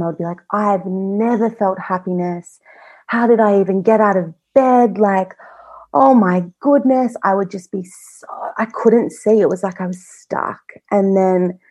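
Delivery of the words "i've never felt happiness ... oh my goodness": retold despair. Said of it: "in my hell time".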